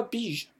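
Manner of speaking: beige said as "beezh"; vowel demonstration. Shown as a demonstration. Teacher asks why it sounds so, beige pronounced "beezh". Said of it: "le E le remporte sur le I".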